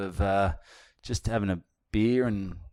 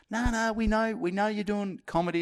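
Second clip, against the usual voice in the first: high, dismissive mock reply; imitating the manager; 10 semitones higher, 5 dB quieter than usual. Manager reassuring the comedian, high and dismissive. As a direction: wave off the worry up high. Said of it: "And say".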